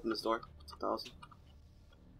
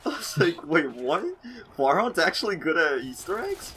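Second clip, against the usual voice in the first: laughing; 6 semitones higher, 5 dB louder than usual. Real, not acted. Retelling own surprise, laughing.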